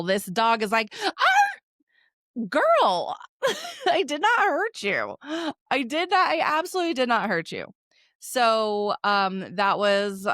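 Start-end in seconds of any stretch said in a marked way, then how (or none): 1.0-2.5 a shrill, hammed-up dog yelp, much higher and louder; dramatic dog yelp. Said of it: "yelps, like, in the most freaking dramatic way possible". 2.5-7.7 mock indignant protest. Owner protests to yelping dog.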